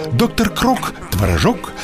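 ordinary talk

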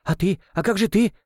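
rising desperate question; desperate cry. A narrator's reading. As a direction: cry out in despair. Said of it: "в отчаянии выкрикнула Нонна". (отчаянный выкрик)

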